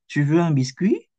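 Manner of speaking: "Tu veux un biscuit?" asked with the pitch rising on biscuit; question by intonation. Shown as a demonstration. Teacher showing a question made by intonation alone, "biscuit" rising. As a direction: lift the end of "biscuit" into a question. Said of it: "langsung pakai intonation".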